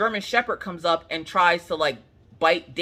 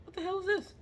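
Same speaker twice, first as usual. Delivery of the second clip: baffled outburst; 9 dB quieter, faster than usual. Retold or imitated. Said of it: "I'm like".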